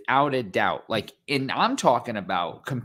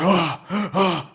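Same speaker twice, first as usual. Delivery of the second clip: scared fan gasps; 4 semitones higher, 5 dB louder than usual. Retold or imitated. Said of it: "They're scared".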